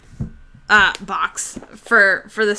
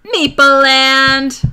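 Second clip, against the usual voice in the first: announcing; 4 semitones higher, 8 dB louder, much slower than usual. Real, not acted. Announcing game title.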